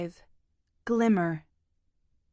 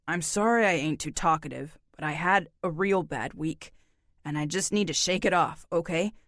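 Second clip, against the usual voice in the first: lower, tired, laconic man; weary drifter voice; 4 semitones lower than usual. Acted. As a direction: speak low like a worn-out loner. Narrator voices John.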